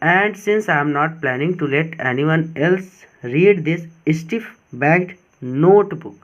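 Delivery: slow, deliberate dictation pace; teacher reading the text slowly for students; slow reading aloud. Shown as a demonstration. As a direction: read each word out slowly and clearly.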